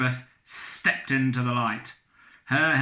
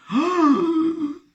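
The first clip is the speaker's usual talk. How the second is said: loud high gasp of recognition; 8 dB louder than usual. Acted. Captain recognizes Elizabeth.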